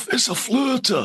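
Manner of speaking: high, hammed-up Scottish Billy Connolly impression; Scottish accent. Comedian recalls the stand-up joke, mock Scottish accent. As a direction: squeal it out in a broad Scottish accent. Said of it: "but in that Scottish accent".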